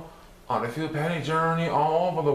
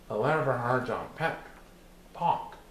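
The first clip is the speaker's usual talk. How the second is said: plain reading voice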